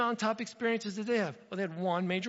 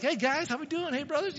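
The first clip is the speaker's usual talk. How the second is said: lightly voiced cheery young David, higher pitch; 8 semitones higher than usual. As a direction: greet your brothers in a higher, casual voice. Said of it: "he shows up like".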